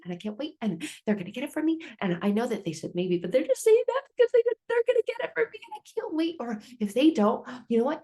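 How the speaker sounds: put-on high, quick child voice, swinging up to squeaky; hopeful kid. Child convincing himself the parents will buy it, high hopeful kid voice. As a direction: talk yourself into hope like a little kid.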